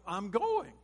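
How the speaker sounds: loud, high, final declaration; acted-out firm refusal to back down; really emphatic. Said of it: "he gets really emphatic with them".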